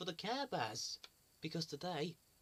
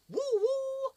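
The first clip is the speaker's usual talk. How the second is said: high loud whistle call woo woo; train whistle woo; 7 dB louder than usual. Pretend. Presenter greeting Thomas to kids, high whistling woo woo. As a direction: sing it out like an engine whistle.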